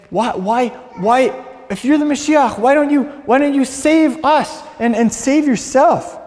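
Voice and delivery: high, loud angry demand; angry screaming. Retold or imitated. Angry criminal screams at the middle man, high and loud. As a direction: yell it up at the man beside you. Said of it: "was screaming at the middleman".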